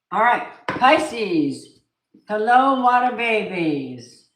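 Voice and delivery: loud, slow, sing-song hello to the viewers; drawn-out, high, loud welcome; big excited greeting. Real, not acted. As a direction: call out to your water babies with delight. Greeting viewers at the start of the reading.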